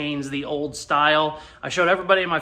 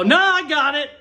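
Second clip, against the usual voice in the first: high, quick-to-refuse retold brush-off; embarrassed brush-off; 9 semitones higher, 4 dB louder than usual. Embarrassed rider turning down two guys' help, acted-out brush-off. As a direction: wave off help in a raised voice. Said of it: "I'm so fucking embarrassed".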